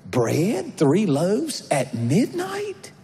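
high, slow disbelieving question; mock outrage at being woken for bread; incredulous. Annoyed friend at midnight.

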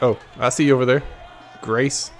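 ordinary talk, nothing marked

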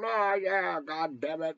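ordinary delivery

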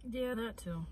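normal talking voice

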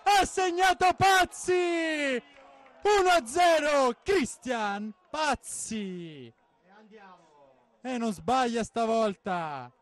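high shouted goal call, stretched; breathless italian goal commentary. Real, not acted. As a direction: yell the goal, drawing out the name.

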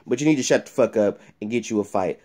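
ordinary talk, nothing marked

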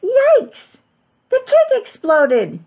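loud, very high alarmed yelp. A narrator's reading. Father sees inside the oven, yelping. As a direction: shriek it in shock. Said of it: "yelped Froggy's father".

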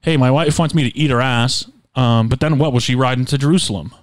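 ordinary talk, nothing marked

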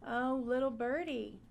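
ordinary talk, nothing marked

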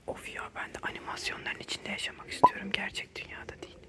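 hushed dreamy whisper. Real, not acted. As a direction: breathe it quietly to the viewers. Cozy quiet room, hushed vlogging.

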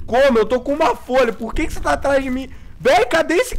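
panicky, pitch shooting up; frantic as the monster closes in; being chased. Real, not acted. Monster chasing him in game.

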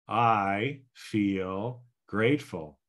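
slow, deliberate I feel grateful; slow affirmation. Shown as a demonstration. Coach demonstrating the ten-by-three affirmation slowly. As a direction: say it slowly, calmly, with conviction. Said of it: "so here we go again".

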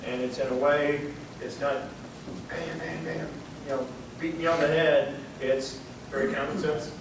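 ordinary talk, nothing marked

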